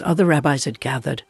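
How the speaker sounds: plain reading voice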